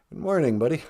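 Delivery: usual speaking voice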